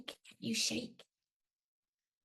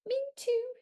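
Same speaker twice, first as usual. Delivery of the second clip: lightly voiced sleepy giant; 4 dB louder than usual. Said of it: "the giant said".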